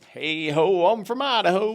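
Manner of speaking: high, loud mock-rustic chant; playful holler. Vlogger joking about the card sender's state, playful holler. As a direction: call it out as a silly rhyme, rising. Playful rhyming shout on Idaho.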